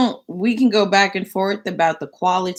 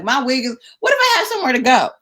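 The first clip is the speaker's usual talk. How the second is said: mock whiny complaint; 7 semitones higher, 3 dB louder, much faster than usual. Complaining to the stylist.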